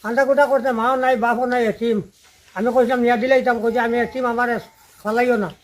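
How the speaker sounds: ordinary delivery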